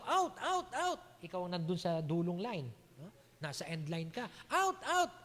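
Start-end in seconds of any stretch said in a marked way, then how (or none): 0.1-1.0 high-pitched sideline call; acted shout. Spectator calls to a player at the line, shouting. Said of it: "May sisigaw". 4.5-5.1 high, quick repeated call; acted spectator's shout. Onlooker misleading a player on the end line, shouting. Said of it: "sigaw ng isang miron".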